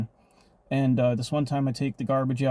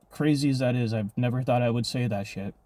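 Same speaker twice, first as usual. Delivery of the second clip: normal talking voice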